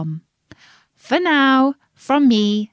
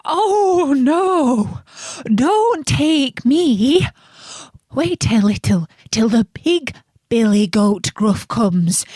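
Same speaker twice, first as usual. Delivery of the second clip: a touch higher, slow pleading goat; middle goat pleading; 5 semitones higher than usual. A narrator's reading. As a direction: beg the troll to wait. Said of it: "who hadn't such a small voice".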